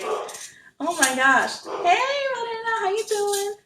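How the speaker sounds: high, excited dog voice; voicing the dog. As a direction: speak as the eager family dog greeting Marina. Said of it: "my dog is barking 'cause he's like".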